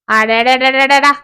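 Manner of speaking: very slow, drawn-out tut of exasperation; wife dragging out her annoyance; exasperated sigh-word. Acted. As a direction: stretch it out like rolling your eyes.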